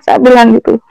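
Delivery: normal talking voice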